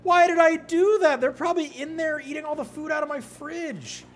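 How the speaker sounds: high, loud and frantic. Real, not acted. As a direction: whine in despair. Panic over house.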